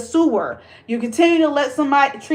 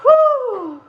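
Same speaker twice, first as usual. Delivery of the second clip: high, loud whew of release; 13 semitones higher, 3 dB louder than usual. Real, not acted. Catching her breath after the rant, loud whew.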